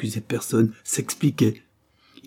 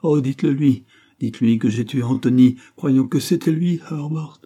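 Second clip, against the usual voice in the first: high, loud, urgent confession; desperate plea; 5 semitones higher, 8 dB louder than usual. Acted. Sick woman begging William to pass on her confession, desperate. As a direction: implore him in a raised voice.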